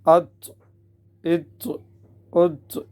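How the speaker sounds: echoing release after each t; qalqalah on taa. Shown as a demonstration. Teacher drills the heavy t with qalqalah bounce. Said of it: "قلقلہ کیا جائے گا".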